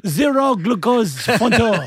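put-on very slow, drawn-out French-accented order; hammed-up French, savouring each word; mock French accent. Host ordering a sugar-free drink, mock French accent.

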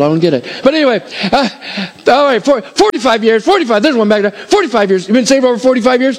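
rally the room like an auctioneer raising bids; auctioneer count-up. Acted.

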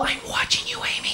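hushed menacing phone-caller whisper; creepy stalker whisper. Acted. Stalker on phone in trailer parody.

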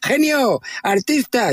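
high, drawn-out call; mock waiter's yell. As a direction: imitate a waiter calling across the bar. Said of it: "me chilla el camarero".

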